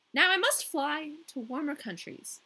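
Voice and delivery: lightly voiced haughty queen; a hint of the Snow Queen. A narrator's reading. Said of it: "said the Snow Queen".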